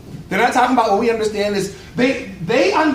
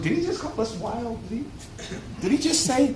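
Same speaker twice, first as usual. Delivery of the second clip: mock shocked murmur; 3 semitones higher, 8 dB quieter than usual. Gentiles reacting to Paul's sermon.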